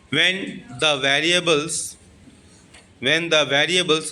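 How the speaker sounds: When the variables drawn out slowly; slow, clear dictation for notes. Shown as a demonstration. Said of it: "V A R I A".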